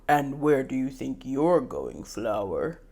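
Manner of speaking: low, smug question; deep male voice. Acted. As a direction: drop the voice low for him. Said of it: "His deep voice greets me".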